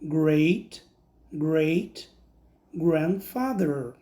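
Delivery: slow careful English "great great grandfather"; English word. Shown as a demonstration. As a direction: say "great great grandfather" slowly and clearly. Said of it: "eu vou dizer". (pronúncia lenta e clara de "great great grandfather")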